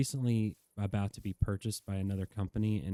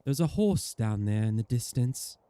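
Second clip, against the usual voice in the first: ordinary talk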